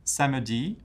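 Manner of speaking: SAMEDI swept up at the end; rising intonation. Shown as a demonstration. Teacher pointing out the tune, SAMEDI rising. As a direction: lift the last syllable of SAMEDI. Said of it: "intonation goes up at the end".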